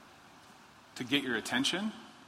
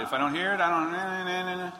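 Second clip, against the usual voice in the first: higher sing-song nah nah nah, tuning God out; mock fingers in ears; 5 semitones higher, faster than usual. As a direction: drown out the voice like a stubborn kid. Mimics blocking out God's voice.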